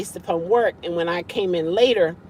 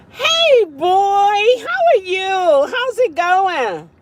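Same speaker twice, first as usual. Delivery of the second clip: pet talk; 11 semitones higher, 5 dB louder, much slower than usual. Real, not acted. Owner greets her dog on the livestream in high cooing baby talk. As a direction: coo it like greeting a beloved pup.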